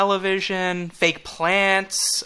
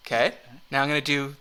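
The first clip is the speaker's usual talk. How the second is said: ordinary talk, nothing marked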